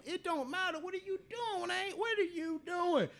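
high, drawn-out disbelieving question; mock shocked confrontation, slow at the end; incredulous demand. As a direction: demand an answer as if you caught her.

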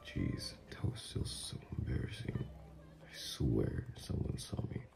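hushed and low, nearly whispered; embarrassed mutter. Acted. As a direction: grumble it privately, mortified. Embarrassed after fitting room incident.

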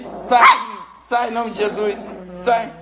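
loud, high commands to a demon; emphatic pretend exorcist; mock exorcism. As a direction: cast out the spirit in play. Play exorcism of the pet.

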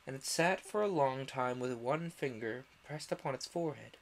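plain reading voice

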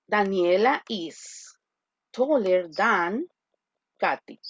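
slow, drawn-out model sentence; slow dictation. Shown as a demonstration. Teacher repeating the model sentence slowly. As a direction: read it out slowly for students. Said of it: "teacher modeling sentence".

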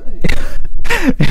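burst of laughter. Real, not acted. Laughing at own joke.

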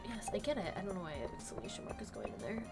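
normal talking voice

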